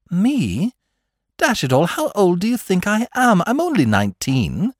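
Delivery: high, incredulous outburst that eases at the end; startled protest. A narrator's reading. Mike is offered an agent's post, startled protest. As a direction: protest in disbelief, pitched well up.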